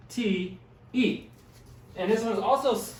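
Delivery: ordinary delivery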